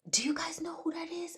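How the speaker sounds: high-pitched half-shouted question; retold scream. Retelling a dream, yelling at hospital staff, high frantic shout. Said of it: "I started screaming to the hospital staff".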